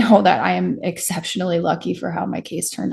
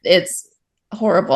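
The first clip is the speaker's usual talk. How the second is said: ordinary talk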